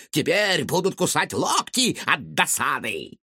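high, gloating mad scientist rant; vengeful mad scientist. A narrator's reading. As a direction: rant about revenge, gleefully. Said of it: "крикнул учёный".

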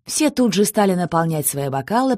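plain reading voice

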